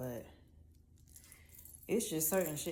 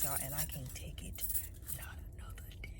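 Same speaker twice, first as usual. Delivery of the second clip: whisper; 5 semitones lower, 9 dB quieter, faster than usual. Real, not acted. Speaker to viewers, whispering in exhaustion. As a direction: drop to a real whisper. Venting, then drops to whisper.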